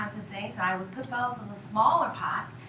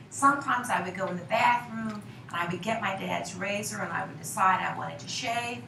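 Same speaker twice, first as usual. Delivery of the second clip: ordinary delivery, nothing marked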